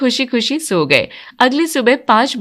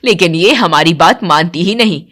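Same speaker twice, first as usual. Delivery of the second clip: lower, grumbling voice of a strict mother-in-law; stern mother-in-law; 5 semitones lower than usual. Acted. Mother-in-law grumbling about her daughter, lower stern voice. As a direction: complain like a strict old matriarch.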